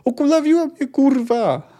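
very high pained scream; shrieked curse of a wounded man. A narrator's reading. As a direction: yell in agony and outrage. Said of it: "darł się Ola Harshem".

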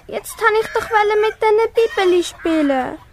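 ordinary delivery

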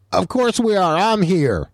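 high, put-on kid voice; Colton. Imitating his son's friend answering the team, high kid voice. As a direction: pitch it up like an overexcited kid. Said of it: "And Colton's response is".

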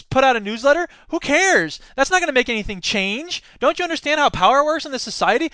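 high, punchy mock exasperation; scornful. Cynical friend dismissing his activism, scornful. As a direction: scoff in rising disbelief at a naive friend.

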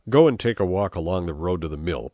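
firm, loud order. A narrator's reading. Chief sending his men off, ordering. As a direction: give it as a loud command at normal pitch. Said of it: "Pepone ordered them".

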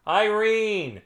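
high, long-held name call. A narrator's reading. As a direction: call through the house in a high voice. Carl calls wife from afar.

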